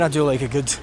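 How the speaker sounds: normal talking voice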